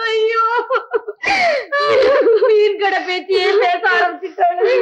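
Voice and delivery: crying out in mock despair; wailing complaint. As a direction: wail it like an insulted wife. Wailing at fishmarket talk. (அழுகையுடன் புலம்பல்)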